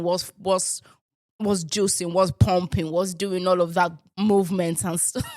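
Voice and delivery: ordinary delivery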